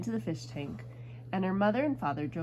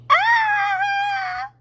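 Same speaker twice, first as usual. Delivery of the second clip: long high loud scream; piercing, sustained storybook scream; mother's yell; 12 dB louder than usual. A narrator's reading. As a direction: shriek as if bitten on the nose. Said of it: "and she yelled".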